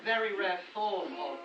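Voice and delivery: ordinary talk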